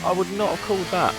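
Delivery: high, excited final-lap commentary; motorsport commentator. Acted. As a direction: build the hype to the finish. Live race play-by-play.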